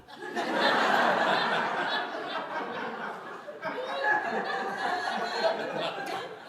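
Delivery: breaking into a long, high, helpless laugh; real laughter, let it run out. Real, not acted. Audience and speaker crack up.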